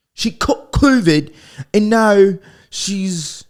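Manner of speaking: milk it like scandalous breaking news; mock dramatic rumor. Telling podcast listeners a rumor, hammed-up alarm.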